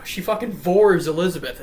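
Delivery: ordinary talk, nothing marked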